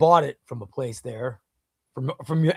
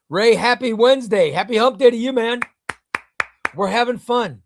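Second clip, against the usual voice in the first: higher and louder hype greeting; cheery greeting; 7 semitones higher, 6 dB louder than usual. Real, not acted. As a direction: greet him with a big upbeat shout-out.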